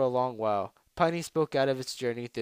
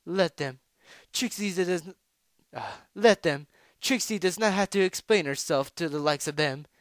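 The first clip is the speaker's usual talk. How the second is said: haughty; 6 semitones higher, 5 dB louder than usual. Acted. Trixie haughty, third person.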